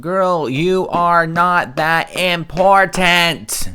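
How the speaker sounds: loud, slow scoffing put-down. Real, not acted. Host scoffing at the streamer's self-importance, slow and loud.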